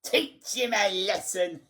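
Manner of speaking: high screech breaking into a cackle; gleeful, high-pitched screeching; shrieking old harridan. A narrator's reading. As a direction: egg on the punisher in a shriek. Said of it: "she was shrieking".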